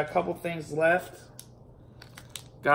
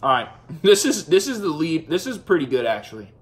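chewing while talking; mouth full; 4 dB louder than usual. Real, not acted. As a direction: food in mouth. Seen 1: Holds bitten marshmallow at mouth, lifts it to lips mid-talk.

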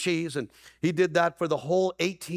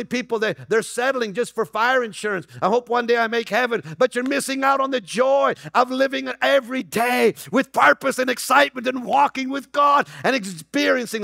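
preaching at full force; 7 semitones higher, 5 dB louder than usual. Real, not acted.

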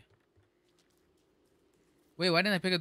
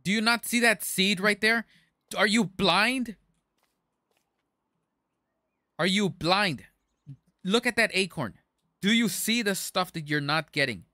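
loud, higher exasperated outburst; yelling; 7 semitones higher, 5 dB louder than usual. Real, not acted. Streamer scolding whoever missed the seed, yelling. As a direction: yell it in disbelief at them. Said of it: "I yelled at you".

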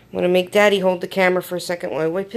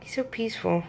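soft, hushed cooing; hushed admiring; 2 semitones higher, 12 dB quieter than usual. Real, not acted. Admiring sleeping newborn softly.

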